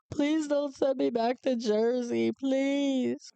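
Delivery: high, begging voice, on the edge of tears; retold tearful plea. Hugging the examiner, begging not to go back, tearful and high. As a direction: hug him and beg not to be sent home. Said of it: "And I was crying hysterically".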